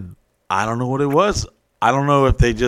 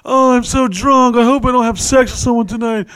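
put-on drunk party girl; 10 semitones higher than usual. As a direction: play the coy drunk girl. Playing drunk bait at club.

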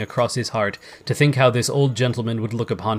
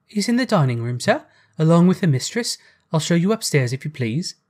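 put-on higher, lilting servant girl's voice; obliging maid; 5 semitones higher than usual. A narrator's reading. Girl offering to show Scrooge upstairs, politely. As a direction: offer help politely in a girlish character voice.

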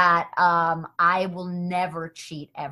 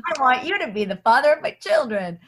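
mock eager; 3 semitones higher than usual. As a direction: gush it like a fan. Mock enthusiastic reply.

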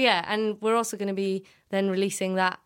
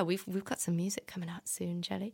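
whisper; 9 dB quieter than usual. Real, not acted. Whispered teaser about new music.

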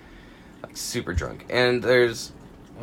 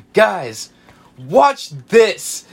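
higher, louder show-off call; cocky show-off; 6 semitones higher, 6 dB louder, much slower than usual. Retold or imitated. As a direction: announce a stunt with drunk pride. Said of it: "he's like".

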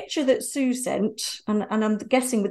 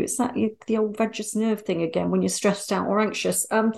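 ordinary delivery

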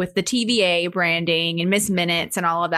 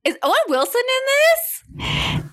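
surprised; slower than usual. Retold or imitated. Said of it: "And I was like".

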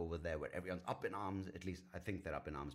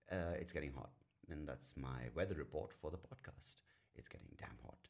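ordinary delivery

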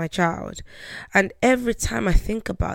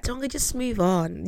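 patronizing; 4 semitones higher, 3 dB quieter than usual. Retold or imitated. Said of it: "Very patronizing".

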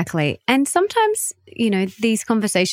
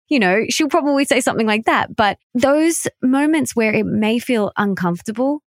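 ordinary delivery

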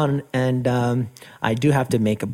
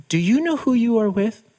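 higher-pitched, pointed question; pointed challenge; 8 semitones higher than usual. Retold or imitated. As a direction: imitate someone squarely confronting a stranger. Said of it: "not bullying her, but he looked at her square in the eye".

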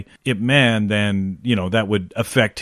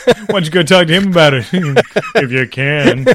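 fast, high, laughing jeer; laughing taunt; 3 semitones higher, 3 dB louder than usual. Retold or imitated. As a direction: throw the dare while cracking up.

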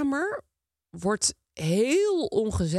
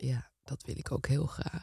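soft whispered yes; whispering; 5 semitones lower, 8 dB quieter than usual. Pretend. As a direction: breathe it out in a whisper. Whispered answer in caring game.

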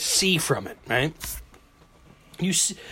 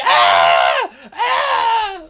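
loud high terrified screams; mock screams; 6 dB louder than usual. Rider waking mid-flip, loud mock terror scream.

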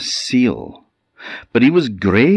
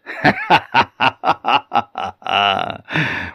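an eerie higher chuckle; merman's laugh; 4 semitones higher than usual. Acted. The merman laughs as the dog is kicked, eerie chuckle. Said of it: "And then the merman laughed".

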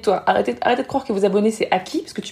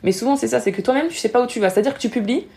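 ordinary delivery

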